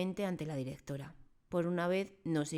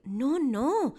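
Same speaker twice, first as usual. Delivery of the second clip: high, loud protest; urgent, loud, higher denial; exclaimed; 11 semitones higher, 10 dB louder than usual. Acted. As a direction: blurt the objection. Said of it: "exclamó la señorita Honey".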